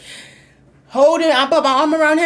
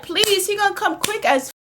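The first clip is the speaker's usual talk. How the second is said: pleading; 8 semitones higher than usual. Retold or imitated. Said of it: "I'm like".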